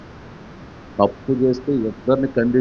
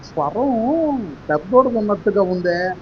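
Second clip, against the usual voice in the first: higher and slowed, wondering aloud; a doubtful old father; old father puzzled; 9 semitones higher than usual. Acted. Blind father questioning the disguised son, slow puzzled voice. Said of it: "అనంటే".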